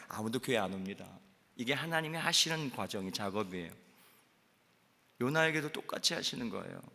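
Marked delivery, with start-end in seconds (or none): none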